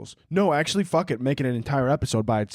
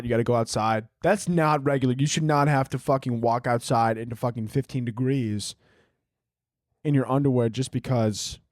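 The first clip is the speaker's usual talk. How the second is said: normal talking voice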